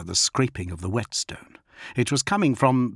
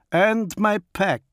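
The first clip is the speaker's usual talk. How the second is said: higher, slow, proud wolf chieftain; Fafnir the wolf lord; 11 semitones higher, 3 dB louder than usual. Acted. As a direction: speak as the feral wolf lord, measured. Fafnir continues.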